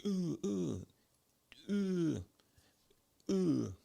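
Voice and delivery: high "ooh" with each punch; mock effort noises, high and spaced out; imitated punching grunts. Guy hitting the bag, imitated high grunts.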